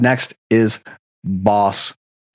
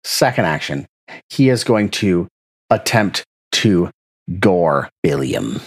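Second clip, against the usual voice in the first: ordinary delivery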